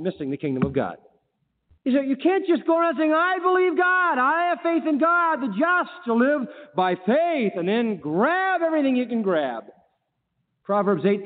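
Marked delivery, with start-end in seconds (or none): 1.8-9.6 impassioned high-pitched sermon; full-force preaching. Acted. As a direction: stretch the key words out from the pulpit.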